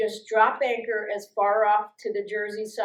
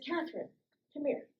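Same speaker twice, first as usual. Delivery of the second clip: much softer, calling her aside; quiet summons; 4 semitones higher, 10 dB quieter, faster than usual. Retold or imitated. The sergeant quietly calling Catherine over, much softer. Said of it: "he says".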